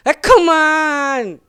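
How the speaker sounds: high, very slow drawn-out cheer; a sports teacher egging on tired kids, high and slow; sports teacher impression. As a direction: stretch out a coach's high-pitched encouragement. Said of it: "did an impression of him".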